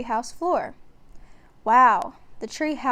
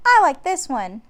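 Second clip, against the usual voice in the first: put-on cheerful girl; 4 semitones higher, 8 dB louder than usual. A narrator's reading. Annie voiced.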